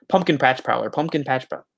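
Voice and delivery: rapid-fire repeats of the name; tongue twister, fast. Shown as a demonstration. Streamer testing a tongue twister for viewers, said fast. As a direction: rattle it off twice as fast. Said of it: "Say that ten times fast".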